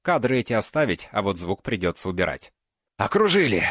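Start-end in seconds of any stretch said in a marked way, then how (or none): 3.0-3.6 breathless, loud gasped shout; out of breath. Acted. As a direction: shout it gasping for air. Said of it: "задыхающийся голос Лонга".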